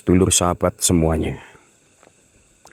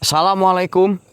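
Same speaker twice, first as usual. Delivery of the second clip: much higher and louder, a far-carrying call; calling out to a spirit; 13 semitones higher, 3 dB louder than usual. Acted. Ghost hunter calling the spirit to appear, loud high calls. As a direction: hail the unseen woman across the dark.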